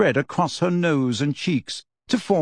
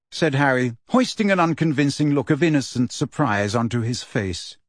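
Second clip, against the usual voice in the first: ordinary delivery, nothing marked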